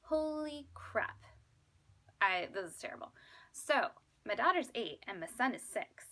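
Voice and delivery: normal talking voice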